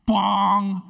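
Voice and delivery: loud imitated bong of a rock on a skull; bonk sound effect. Teller mimics the rock striking his head, a loud bong. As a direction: make the noise of a rock hitting your head.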